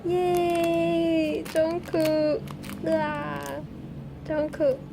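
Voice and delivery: high, delighted fan cheer; real gleeful squealing; happy squeal. Real, not acted. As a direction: cheer the name with drawn-out joy. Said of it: "like, so happy right now".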